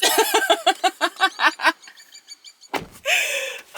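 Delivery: high, loud burst of laughter; big real laugh at a joke; loud laughing fit. Real, not acted. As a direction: crack up helplessly. Still laughing in car.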